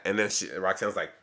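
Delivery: usual speaking voice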